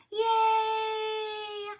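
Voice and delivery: high, sung cheer; a joyful high yay. Real, not acted. Librarian cheering for the kids after the song.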